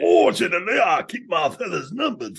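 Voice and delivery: high-pitched cartoon bluster; blustery cartoon voice. Riffing on cartoons with co-hosts, blustery cartoon voice. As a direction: puff it up high, like a cartoon blowhard. Impersonating a cartoon character on request-free riff.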